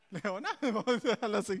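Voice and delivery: giggling through the words; laughing. Real, not acted. Preacher laughing at himself mid-sermon, giggling. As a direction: laugh it off and move on. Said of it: "yo me río".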